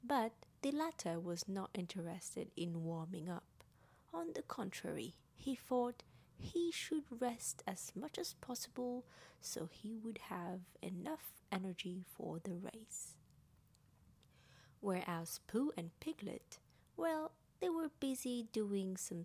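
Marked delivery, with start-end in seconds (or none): none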